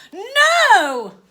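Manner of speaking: flat refusal; refusing shout. Acted. Girl refuses.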